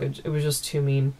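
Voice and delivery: normal talking voice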